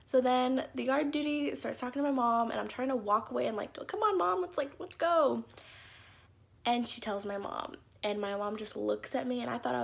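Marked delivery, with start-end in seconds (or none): 3.9-5.4 high, hurried nagging to leave; urgent pleading. Retold or imitated. Little girl pulling her mom away from the yard duty, high urgent pleading. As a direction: whine to get mom out the door.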